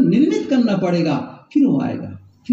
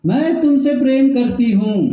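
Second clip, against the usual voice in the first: higher, insistent imitation of the wife; forceful retold reply; 5 semitones higher than usual. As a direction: call it back forcefully to the husband. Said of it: "अब वो भी तेजी से बोलती है". (ऊँचे सुर में, ज़ोर देकर)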